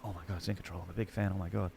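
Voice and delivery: hushed Australian fanboy awe; awed hush. Retold or imitated. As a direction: gasp it out like meeting your idol. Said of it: "I'm like".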